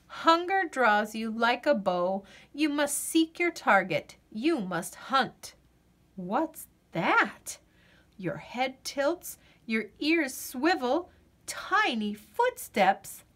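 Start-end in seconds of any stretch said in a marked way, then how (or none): none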